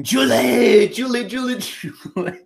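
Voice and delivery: put-on high-pitched wail; mock calling out. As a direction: cry the name, then tail off. Comic imitation, calling a name.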